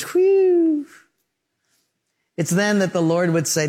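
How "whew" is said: high, whistled sigh of relief; exaggerated high relief sound; relieved whew. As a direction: let out a big imitated "whew". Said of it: "and everyone goes".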